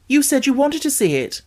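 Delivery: high, baffled objection; confused why she refuses now; puzzled protest. A narrator's reading. Colin reminding Mary she wanted it, baffled.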